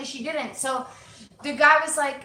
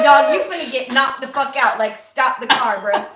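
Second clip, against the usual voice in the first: angry threat; 9 dB louder than usual. Retold or imitated. Threatening driver to stop car.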